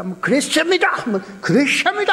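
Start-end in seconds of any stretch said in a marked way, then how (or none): 0.2-0.9 "그랬습니다" pitched high, ending on a rise; a light mimic of a slick interpreter's ringing delivery; imitating the interpreter. Interpreter rendering Billy Graham's sermon, a light mock imitation. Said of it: "얼마나 쇠소리로 또 번역을 잘합니까". 1.4-2.1 "그랬습니다" pitched higher, with a ringing rise at the end; a hammed-up, showy interpreter voice; imitating the interpreter, stronger. Interpreter rendering Billy Graham's sermon, a hammed-up mimic. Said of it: "얼마나 쇠소리로 또 번역을 잘합니까".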